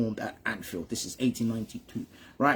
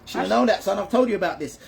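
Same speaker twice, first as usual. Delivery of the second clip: higher, louder telling-off; raised-voice reproach; scolding; 7 semitones higher, 3 dB louder than usual. Real, not acted. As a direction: chide a pupil who got it wrong. Scolds listener for wrong answer.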